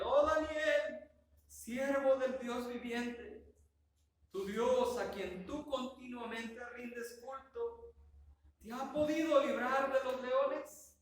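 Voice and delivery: slow, high, loud lament; drawn-out, high-pitched anguished call; sad, desperate voice. Acted. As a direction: plead across the pit in anguish. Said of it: "Dice con voz triste, incluso podría decir yo con voz desesperada".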